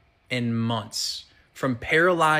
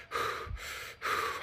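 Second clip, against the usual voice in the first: long breathy exhausted sigh, much softer; heavy sigh; 11 dB quieter than usual. Retold or imitated. Answering the flight attendant mid-panic with a soft sigh. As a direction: let the air out, drained.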